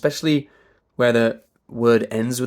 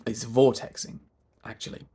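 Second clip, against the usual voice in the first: ordinary talk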